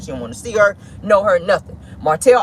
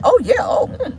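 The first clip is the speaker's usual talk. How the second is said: swooning; 12 semitones higher than usual. Retold or imitated.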